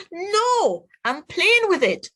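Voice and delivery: put-on high, loud stubborn kid; defiant child. The other boy refusing Josh the truck, high defiant yell. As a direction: yank back with a high childish protest. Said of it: "the boy yanked back with all his might".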